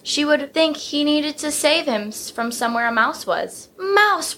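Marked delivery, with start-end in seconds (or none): 3.8-4.4 screamed warning. A narrator's reading. Jokester elephant scaring his family, high scream. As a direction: shout it to scare the family. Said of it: "Ronald would scream behind Papa".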